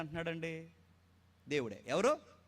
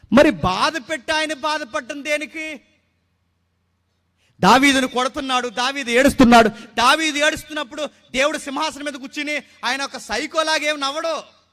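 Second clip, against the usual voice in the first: loud, high, impassioned sermon delivery; fervent preaching; 9 semitones higher, 14 dB louder than usual. Acted. Pastor preaching to the congregation, loud and fervent.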